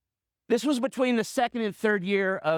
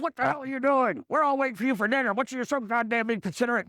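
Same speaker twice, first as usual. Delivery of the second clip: raised-pitch, rapid mock scolding; dad going off; 3 semitones higher than usual. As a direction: imitate an angry dad ranting at his kid. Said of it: "just going off".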